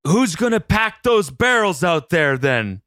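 loud, high, slow New Jersey surfer drawl; New Jersey surfer accent. Acted. Surfer dad asking who will ride the waves, in a New Jersey accent. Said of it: "you have, like, an accent".

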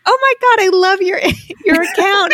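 high, laughing gush; fangirling. Retold or imitated. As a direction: starstruck mom meeting an influencer. Mom mistakes therapist for influencer.

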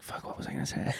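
panicked whisper. Retold or imitated. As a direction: whisper it under your breath. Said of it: "I was like".

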